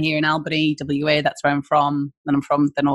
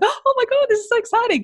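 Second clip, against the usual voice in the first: much higher, thrilled squeal; excited. Retold or imitated. Guest reliving the urge to travel, high excited squeal. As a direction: burst out with giddy delight. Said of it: "I was like".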